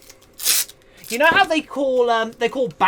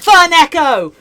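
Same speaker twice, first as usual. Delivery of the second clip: shout; 4 semitones higher, 8 dB louder than usual. Real, not acted. Calling out chatter's name.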